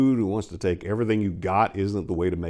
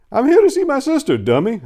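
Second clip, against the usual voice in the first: high and loud teasing brother; joking jab; 7 dB louder than usual. A narrator's reading. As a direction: tease her loudly and happily. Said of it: "I joked at her".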